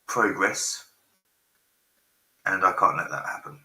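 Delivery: usual speaking voice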